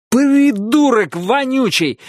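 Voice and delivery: slow, high yell. Acted. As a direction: yell it out in fury. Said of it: "проорал я".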